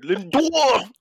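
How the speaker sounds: high, loud Boston shout; Boston accent. Retold or imitated. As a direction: imitate a Boston heckler calling out. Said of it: "you had the Boston accent".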